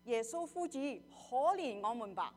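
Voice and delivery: high, loud plea to Jesus; loud cry for mercy. Acted. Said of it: "高聲說". (高聲呼求)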